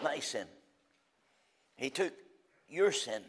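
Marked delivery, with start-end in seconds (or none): none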